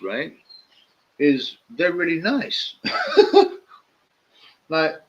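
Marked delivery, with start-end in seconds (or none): 2.8-3.6 a sudden high squeal of a laugh; high burst of laughter. Real, not acted. Laughing at his own drug dealer story.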